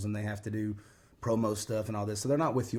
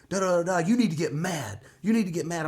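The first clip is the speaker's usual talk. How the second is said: higher, louder imitation of a demanding trainer; mock scolding; 8 semitones higher, 6 dB louder than usual. Trainer pushing contestant to get angry, mock stern. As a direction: press the order home, playfully retold.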